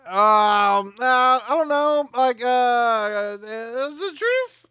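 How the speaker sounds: high, hesitant groping for an idea; stall, then squeak out a drawn-out guess; mock uncertainty. Acting out a writer groping for answers.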